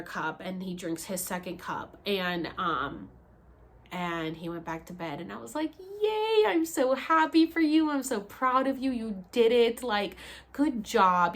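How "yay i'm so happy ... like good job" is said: high, slow and louder praise; proud cheer. Retold or imitated. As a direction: cheer him on like a proud coach. Cheering man after second cup.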